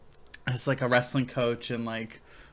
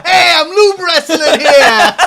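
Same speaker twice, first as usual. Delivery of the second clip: slow, loud laughing Brooklyn voice; hammed-up Brooklyn wiseguy; Brooklyn accent; 16 dB louder, slower than usual. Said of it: "angry Brooklyn guy".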